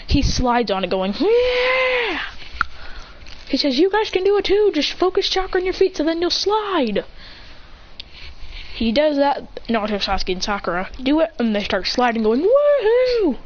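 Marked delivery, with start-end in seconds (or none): 1.2-2.2 high, drawn-out mock cheer; gleeful cheer. Dominic slides along on the wood, gleeful cheer. Character cheers while sliding. 3.8-7.0 high, animated urging; eager encouragement. Acted. Dominic tells the team they can slide too, eager encouragement. Character urges team to join. 12.4-13.4 high, long thrilled whoop; gleeful whoop. Acted. The team starts sliding on the wood, gleeful whoop.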